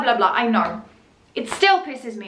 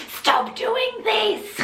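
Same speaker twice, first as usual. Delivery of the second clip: high, slow, hammed-up protest; exaggerated high comment voice; mock pleading complaint; 8 semitones higher, slower than usual. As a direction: stretch it out high like a put-on complaint. Reading out a hostile comment, mockingly.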